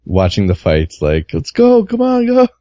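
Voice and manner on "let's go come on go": high-pitched imitation of an excited fight watcher; animated cheering. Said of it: "who was very animated".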